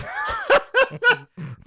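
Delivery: high, loud burst of laughter; real laughing fit. Real, not acted. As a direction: crack up at your own story.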